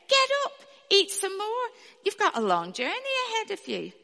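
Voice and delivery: high, bright angel command. Acted. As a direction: call him awake from above. Angel wakes Elijah again.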